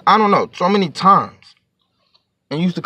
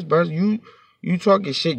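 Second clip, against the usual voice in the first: ordinary talk, nothing marked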